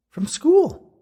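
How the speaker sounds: loud, very high female voice; prompting ghost woman. A narrator's reading. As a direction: squeak out a hopeful reminder as a question.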